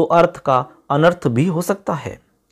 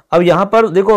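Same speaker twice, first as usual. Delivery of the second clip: ordinary talk, nothing marked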